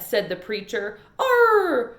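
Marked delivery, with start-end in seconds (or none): 1.2-1.9 high loud wailing "Oh"; dog wail. Acted. Winn-Dixie wailing outside during the sermon. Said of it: "wailed Winn-Dixie".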